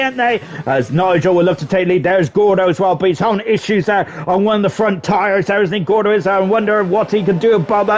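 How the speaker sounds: ordinary talk, nothing marked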